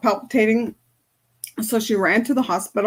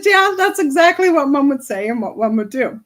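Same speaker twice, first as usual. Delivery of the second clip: retold eager agreement, higher and louder; raised, insistent retold "yes, exactly"; emphatic agreement; 4 semitones higher, 6 dB louder than usual. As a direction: agree emphatically, as if quoting her recognition. Retold delighted recognition of mom's ways.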